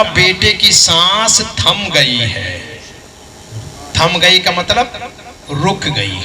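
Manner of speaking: ordinary delivery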